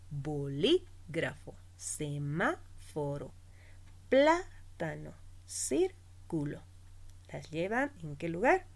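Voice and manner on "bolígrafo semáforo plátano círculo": slow, with LÍ, MÁ, PLÁ, CÍR held in Bolígrafo, semáforo, plátano, círculo; stress on antepenultimate syllable. Shown as a demonstration. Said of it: "llevan el acento en la antepenúltima". (acento alargado en LÍ, MÁ, PLÁ, CÍR de Bolígrafo, semáforo, plátano, círculo)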